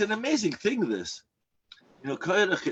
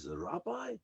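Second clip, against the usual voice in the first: higher, shocked little question; 7 semitones higher, 8 dB quieter than usual. Retold or imitated. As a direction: repeat it in disbelief.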